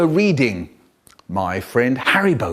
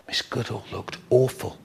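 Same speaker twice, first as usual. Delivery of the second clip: usual speaking voice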